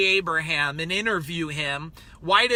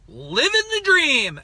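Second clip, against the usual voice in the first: drawn-out, high and loud, with a sneer; condescending; 6 semitones higher, 5 dB louder, slower than usual. Retold or imitated. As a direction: say it like you look down on them. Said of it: "like, a condescending way".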